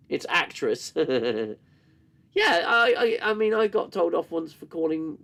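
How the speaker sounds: ordinary talk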